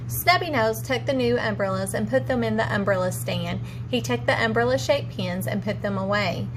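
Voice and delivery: plain reading voice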